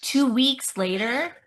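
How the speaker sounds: drawn-out incredulous question, rising. Real, not acted. Listener to storyteller on shocking news, incredulous. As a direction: stretch it out in disbelief.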